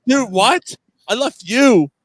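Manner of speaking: loud, high, incredulous comeback; indignant retort. Retold or imitated. To the friend who accuses him of leaving, indignant shouting. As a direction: throw the accusation right back, high and loud.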